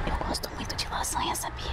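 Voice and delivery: breathy secret whisper. Real, not acted. Whispering a secret to the chat about lasagna. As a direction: whisper it so nobody hears. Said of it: "eu falo baixo pra ninguém ouvir".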